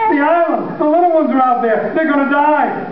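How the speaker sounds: high, frantic cry; father pleading with his sons as he sobs; desperate father. Acted. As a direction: plead through panic. Father panics, then sobs.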